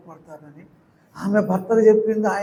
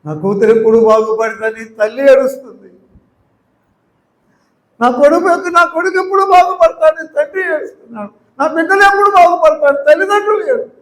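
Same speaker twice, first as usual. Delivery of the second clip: very high, loud preaching cry; pleading preacher's wail; 10 semitones higher, 10 dB louder than usual. Acted. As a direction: cry it out over the congregation, at the peak. Preaching climax peaks higher.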